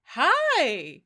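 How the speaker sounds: high, loud, hammed-up hello to a stranger; overeager American greeting. Mocking how Americans greet each other abroad, hammed-up high cheer. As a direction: gush like a thrilled tourist spotting compatriots. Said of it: "if you see other Americans in Europe".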